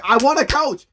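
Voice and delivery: loud, high-pitched exclamation; blurted confession. Retold or imitated. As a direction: blurt it out at the top of your voice. Shoresy blurts out his confession.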